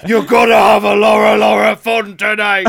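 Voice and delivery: loud, brassy mock TV host; game show host catchphrase. Hosting a dating show for the studio audience, mock showbiz shout. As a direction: belt it out like a Saturday night host.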